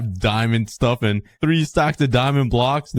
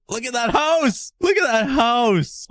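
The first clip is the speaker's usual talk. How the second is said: high, thrilled cry, the second slower; pointing it out in delight; amazed; 12 semitones higher than usual. Real, not acted. Amazed at transformed house.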